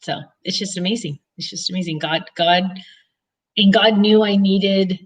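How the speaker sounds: ordinary talk, nothing marked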